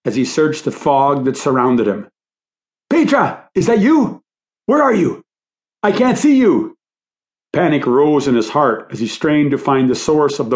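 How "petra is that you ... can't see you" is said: high, anxious calling into fog; urgent high-pitched searching cry; panicked calling out. A narrator's reading. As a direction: call out desperately for someone lost.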